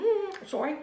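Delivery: talking through a mouthful of cookie; muffled words round a cookie; mouth full. Real, not acted. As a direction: apologize around a bite. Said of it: "speaking with my mouth full".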